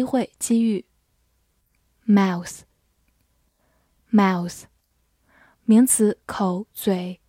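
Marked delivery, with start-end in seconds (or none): none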